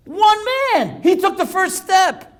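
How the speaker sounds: high, loud exclamation; rousing sermon cry; impassioned preaching. Real, not acted. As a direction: proclaim it to the whole room, stretching the words. Preacher drives home the moral.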